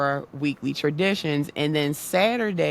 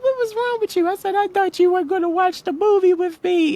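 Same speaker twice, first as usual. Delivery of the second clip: put-on upset child; 13 semitones higher, 5 dB louder, faster than usual. Child crying to dad.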